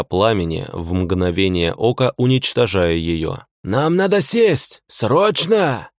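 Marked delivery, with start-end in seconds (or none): 3.6-5.9 urgent high shout. A narrator's reading. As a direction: yell it over the noise. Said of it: "закричал Симонов".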